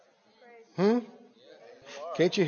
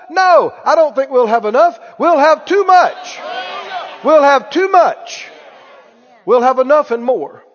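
louder triumphant proclamation; bold declaration; 3 semitones higher, 5 dB louder than usual. Real, not acted. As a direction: shout the abundance out. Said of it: "preaching a bold confession".

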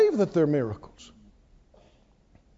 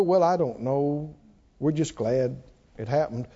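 low, dismissive doubter voice, softer; mock skeptic; 7 semitones lower, 4 dB quieter than usual. Mocking believers who reason miracles away, dismissive low voice. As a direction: shrug it off like a doubter, lower.

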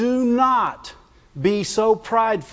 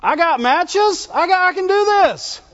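high, puffed-up bragging king; boastful Uzziah; 7 semitones higher than usual. Retold or imitated. Preacher voicing King Uzziah defying the priests, boastful. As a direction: brag like a spoiled king who can't be told no. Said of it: "because he wanted".

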